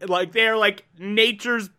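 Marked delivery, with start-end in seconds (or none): none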